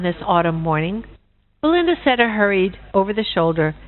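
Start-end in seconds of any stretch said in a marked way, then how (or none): none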